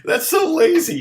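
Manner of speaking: high, mock-exasperated protest; exasperated retort. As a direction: scold the band for lazy lyrics, high and indignant. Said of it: "And I'm like".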